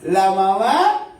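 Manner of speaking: hammed-up impassioned sermon; preaching at full force. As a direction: proclaim it to the congregation. Preaching with raised voice.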